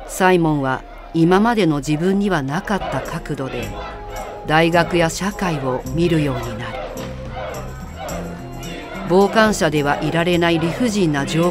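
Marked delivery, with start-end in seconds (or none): none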